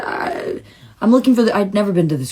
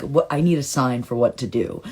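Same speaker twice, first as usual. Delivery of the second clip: normal talking voice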